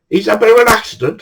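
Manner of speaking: fast, high-pitched explanation; placating. Retold or imitated. Teller to Victor's missus about the accident, hurried placating. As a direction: break bad news in a high, hurried voice. Breaking news to Victor's wife.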